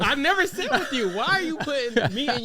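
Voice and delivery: indignant. Real, not acted. Defensive protest.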